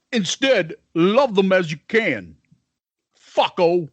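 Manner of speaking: high-pitched, put-on rocker impression. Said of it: "I gotta work on my [name] fucking impression".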